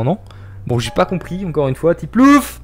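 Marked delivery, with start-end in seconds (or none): 1.9-2.6 high, pleading call out. Real, not acted. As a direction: beg a friend to come help. Appelle un ami à l'aide. (appel suppliant et aigu)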